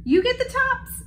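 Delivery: high singsong wake-up call; storybook hare calling out, pitched high. A narrator's reading. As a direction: call across the field to a sleeper. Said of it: "Hare called out".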